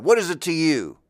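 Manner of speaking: a sharp rhetorical comeback; defiant snap. Shown as a demonstration. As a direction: throw it back at them, confrontational. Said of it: "Oh, maybe I'd say it like that".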